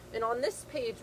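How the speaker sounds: usual speaking voice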